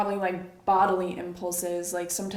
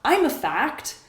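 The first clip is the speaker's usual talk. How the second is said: higher, self-assured announcement; a thought declaring itself as truth; pitched-up voice of a cocksure thought; insistent thought; 7 semitones higher, 4 dB louder, slower than usual. Acted. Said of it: "a thought comes in and says".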